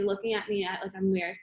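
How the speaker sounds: usual speaking voice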